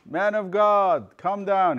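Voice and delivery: loud, high, drawn-out summons; high, stretched-out imperious shout; commanding call. Retold or imitated. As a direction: call up to him like an order. Arrogant messenger orders the prophet down.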